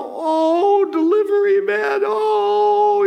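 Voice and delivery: high, loud, swooning adoration; mock worship. Preacher mimicking someone bowing to the delivery man, high mock adoration. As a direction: gush over the delivery man like a devotee. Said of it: "bow down and go".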